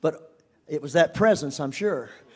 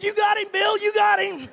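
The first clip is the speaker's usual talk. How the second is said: high, loud gleeful reply; laughing agreement; 14 semitones higher, 6 dB louder than usual. Retold or imitated. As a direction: egg him on, delighted.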